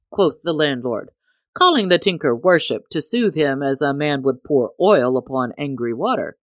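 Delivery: ordinary delivery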